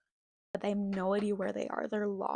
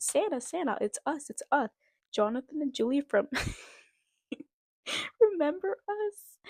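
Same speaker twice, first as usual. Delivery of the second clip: a lightly voiced children's call, higher; 5 semitones higher, 3 dB louder than usual. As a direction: call up to him with a laugh breaking in. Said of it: "They both shouted".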